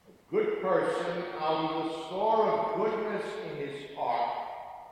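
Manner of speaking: solemn proclaimed scripture reading; Gospel reading. Acted. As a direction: proclaim it like at Mass.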